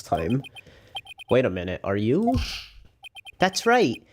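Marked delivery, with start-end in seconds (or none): 3.4-4.0 put-on a proud young monk offering help. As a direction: Karate Kid style boy voice. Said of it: "that's the voice I'll give him".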